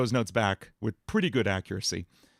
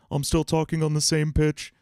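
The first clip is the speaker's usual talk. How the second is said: same pitch, larynx lowered, darker and louder; lowered-larynx dopey cartoon voice; demonstrating Patrick's dropped larynx; 5 semitones higher, 4 dB louder than usual. Shown as a demonstration. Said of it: "Or Patrick".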